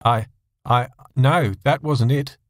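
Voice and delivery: halting, stammering answer. A narrator's reading. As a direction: stumble over the words. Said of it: "John stammered his answer".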